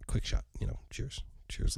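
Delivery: ordinary talk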